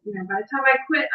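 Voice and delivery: ordinary talk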